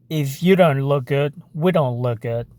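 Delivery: low hair-advert voice; put-on advert voice. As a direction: drop deep like a commercial spokesman. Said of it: "I love that voice".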